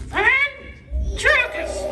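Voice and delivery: very slow, high parrot voice; parrot attempt. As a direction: draw "ventriloquist" out in a squeaky parrot voice. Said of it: "That sounds better".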